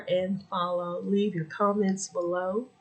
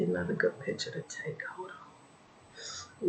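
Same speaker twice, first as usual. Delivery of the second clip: whisper; 2 semitones higher, 12 dB quieter than usual. Real, not acted. Whispers while taking a photo.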